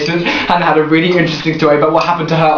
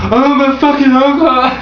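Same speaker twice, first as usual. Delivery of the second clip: put-on drunk customer; 6 semitones higher than usual. Girl retelling a drunk customer at her till, mock slurred. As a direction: imitate a drunk swearing at the till.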